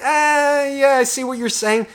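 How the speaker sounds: drawn-out high "ah" of agreement; conceding. Acted. Inner voice half agreeing that anger is a gift, high-pitched. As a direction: pitch it high, grudgingly going along. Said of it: "and it's just like".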